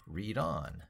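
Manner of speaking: usual speaking voice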